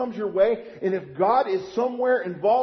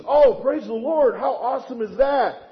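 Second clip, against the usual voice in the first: mock relieved joy; 4 semitones higher, 6 dB louder than usual. Acted relieved patient rejoicing.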